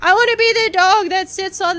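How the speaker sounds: hammed-up weepy whine; fake crying. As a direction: blubber like a kid refusing chores. Whining about adult chores.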